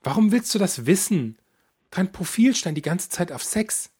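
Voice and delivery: a touch of a nosy, cheerful mum; mother blurting it out at dinner; mother's voice. Acted.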